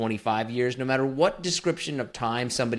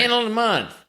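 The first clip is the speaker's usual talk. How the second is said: put-on a much higher, louder take on an Indiana accent; 12 semitones higher, 5 dB louder than usual. Asked for an Indiana accent, he belts the title in a hammed-up Indiana accent. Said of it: "Indiana accent".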